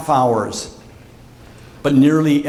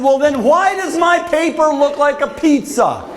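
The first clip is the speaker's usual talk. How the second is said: very high comic whine; baffled protest; 12 semitones higher than usual. Retold or imitated. Student questions the professor about his marked paper, high baffled protest. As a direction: demand an answer in a high squawk.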